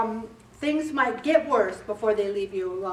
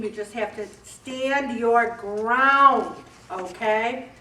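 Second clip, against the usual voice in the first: usual speaking voice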